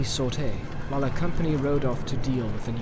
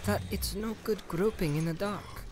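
higher, soft careful hobbit voice; softer, higher, measured hobbit bargaining; 5 semitones higher, 9 dB quieter than usual. Acted. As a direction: pick each word carefully, softly. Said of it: "choosing his words carefully".

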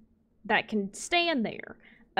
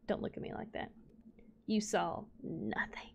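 hushed warning; 9 dB quieter than usual. Pretend. Gamer to an onlooking animal after losing patience, hushed warning. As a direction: whisper it like a mock cover-up.

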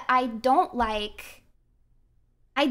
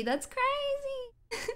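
high squeaky disbelief; 11 semitones higher, 9 dB quieter, much slower than usual. Real, not acted. Disbelief after winning on one HP.

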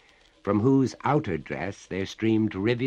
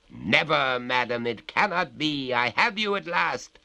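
raised, louder refusal; triumphant master of Harrowby; 8 semitones higher, 4 dB louder than usual. Acted. Master has trapped the ghost at last, triumphant.

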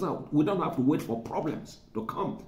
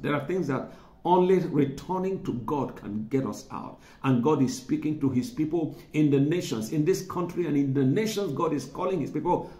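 usual speaking voice

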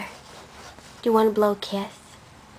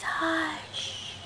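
put-on old woman whispering; 6 semitones higher, 7 dB quieter than usual. Shown as a demonstration. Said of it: "how does the quiet old lady whisper hush".

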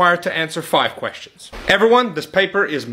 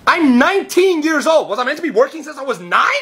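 exasperated; 8 semitones higher, 3 dB louder than usual. Acted. Job seeker exasperated.

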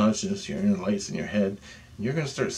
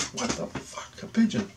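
acting out shock at a pigeon appearing beside you; startled disbelief; 2 semitones higher, 4 dB quieter than usual. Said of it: "You're like".

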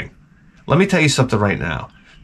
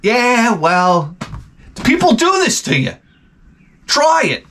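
much higher, squeaky voice; shrill mock guest; 11 semitones higher than usual. Guest defends early bedtime to host, squeaky. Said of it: "It's Saturday".

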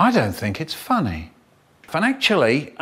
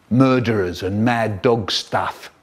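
usual speaking voice

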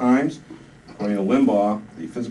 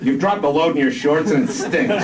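high, loud angry shout; 8 semitones higher, 5 dB louder than usual. Acted. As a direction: bark it at him. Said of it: "shouted Buchanan".

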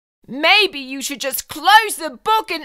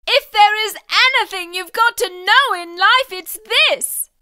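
usual speaking voice

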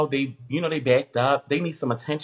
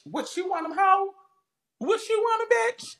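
high mocking sing-song taunt, much higher. Livestreamer taunting jealous commenters in a high mocking voice. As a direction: tease the haters way up high.

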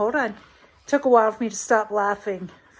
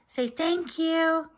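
slow, pitched-up coaxing prompt; coaxing pet; 6 semitones higher, slower than usual. Real, not acted. Owner prompts Mary to thank the giver, coaxing.

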